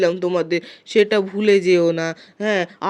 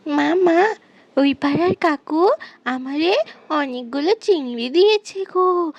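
put-on pleading child; 4 semitones higher, 4 dB quieter than usual. Girl begging mother for biryani.

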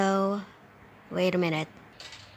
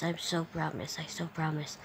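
soft; 4 semitones lower, 9 dB quieter than usual. Shown as a demonstration. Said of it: "repeated softer".

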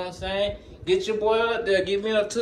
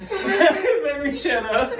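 laughing it out, slowed and high; cracking up while repeating himself; 9 semitones higher, much slower than usual. Real, not acted. Vlogger laughing at his own rambling.

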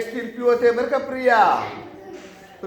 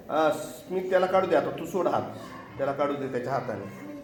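soft gentle correction; 7 semitones lower, 7 dB quieter, faster than usual. Real, not acted. Teacher gently correcting child.

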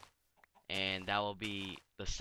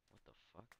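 hushed startled whisper; 23 dB quieter, much faster than usual. Real, not acted. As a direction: breathe it out in disbelief. Startled by something weird.

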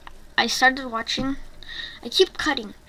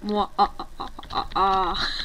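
mock evil laugh; 4 semitones lower than usual. Player gloats over secret kills in game, mock evil laughing. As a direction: laugh like a pretend villain, in separate beats.

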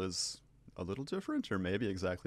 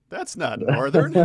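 laughing retort; 7 semitones higher, 15 dB louder, slower than usual. Retold or imitated. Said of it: "He's like".

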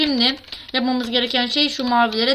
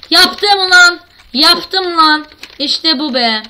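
loud, high victory shout; triumphant cheer; 5 semitones higher, 7 dB louder, much slower than usual. Real, not acted. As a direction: shout with relief and joy. Sonunda geçiyor. (sevinçle bağırıyor)

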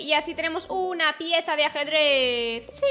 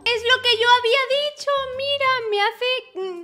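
excited realization; 9 semitones higher, 6 dB louder than usual. Real, not acted. Realizes she was right.

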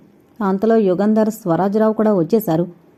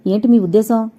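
plain reading voice

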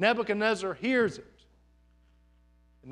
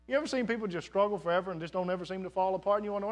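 ordinary talk

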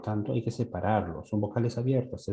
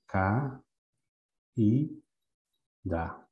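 "Ca, i, da" con una pausa tras cada sílaba; sílabas separadas; much slower than usual. Shown as a demonstration. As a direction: marca "Ca, i, da" sílaba por sílaba. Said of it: "Lo voy a separar así". ("Ca, i, da" separado, sílaba a sílaba)

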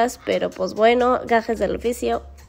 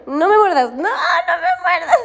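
laughing, wailing plea; 6 semitones higher, 4 dB louder than usual. Real, not acted. Woman begging dog not to bite, squealing plea.

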